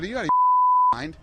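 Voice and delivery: mock-shriek it at the fighter; a hammed-up cry of disbelief at the fighter for leaving the cage; retold outburst. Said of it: "I said".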